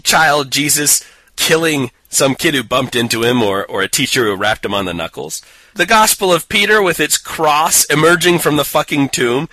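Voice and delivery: usual speaking voice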